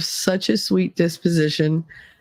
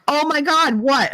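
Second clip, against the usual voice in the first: higher-pitched mock exasperation; exasperated reaction; 9 semitones higher than usual. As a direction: imitate a baffled partner's outburst. Retells husband's exasperated reaction to tears.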